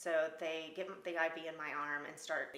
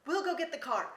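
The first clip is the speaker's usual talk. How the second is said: higher, louder urgent promise; urgent guide; 8 semitones higher, 8 dB louder than usual. Retold or imitated. Guide promising to fetch the car, urgent and high. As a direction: rush it like the guide. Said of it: "he's like".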